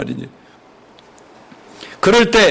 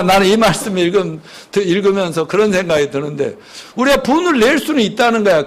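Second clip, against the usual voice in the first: ordinary delivery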